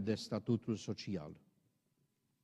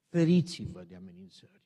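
forceful warning; 8 semitones higher, 7 dB louder than usual. Acted. Preacher repeats warning with force.

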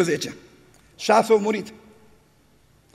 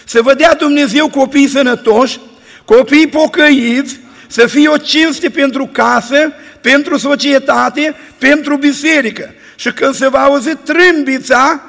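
full-force preaching; 5 dB louder than usual. Acted. Preacher blessing the church at the sermon's end, full-force preaching.

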